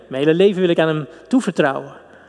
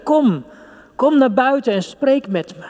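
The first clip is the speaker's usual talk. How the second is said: pitched higher, pleading call; God calling out; 7 semitones higher than usual. Acted. God calling his beloved to come out and speak, higher and pleading. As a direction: voice God inviting you out, higher and urgent. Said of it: "hij hangt in onze lippen en zegt".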